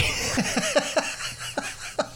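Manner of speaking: soft, high, breathless chuckling; real wheezy laugh. Real, not acted. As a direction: laugh helplessly at a jab at yourself. Laughs at the retold jab.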